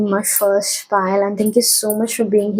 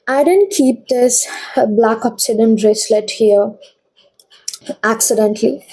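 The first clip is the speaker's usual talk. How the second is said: ordinary talk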